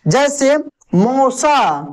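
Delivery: मौसा with a slow, stressed औ; deliberate slow emphasis; stressed vowel. Shown as a demonstration. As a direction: lean on the औ in मौसा. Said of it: "औ की मात्रा में जोर लगेगा".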